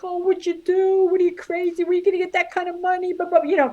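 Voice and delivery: high, fast mock-scolding mother; imitating worried mom. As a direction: squawk it like a shocked Italian mom. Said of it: "I was afraid she'd be like".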